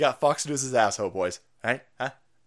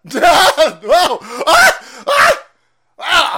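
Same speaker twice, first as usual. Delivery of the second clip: laughing high shouts; loud high whoops; excited yelling; 10 semitones higher, 13 dB louder, much slower than usual. Real, not acted. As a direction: yell with glee. Laughing cheers at clip.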